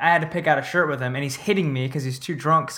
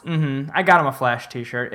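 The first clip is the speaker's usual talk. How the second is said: ordinary talk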